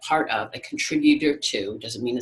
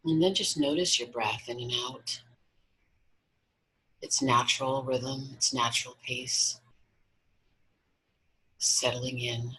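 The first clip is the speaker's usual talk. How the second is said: guided meditation. Acted. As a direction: guide them gently into relaxation, unhurried.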